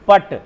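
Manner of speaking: पट as one closed syllable; dropped final vowel. Shown as a demonstration. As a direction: end पट on the consonant ट, no vowel after it. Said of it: "यहाँ पर लोप हो गया". (पट, आख़िर में स्वर का लोप)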